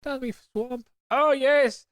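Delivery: high-pitched in-character ogre voice; Shrek. Acted. Hamming up a film ogre for podcast mates, in character. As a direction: put on a high, hammy ogre accent. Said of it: "have to get into character".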